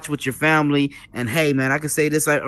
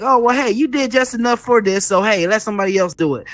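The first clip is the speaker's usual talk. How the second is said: higher-pitched imitated inner voice; lightly voiced fame bug; 6 semitones higher than usual. Fame bug in a creator's ear, lightly voiced coaxing. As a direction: lightly mimic a nagging thought, pitched up. Voicing the fame bug's talk.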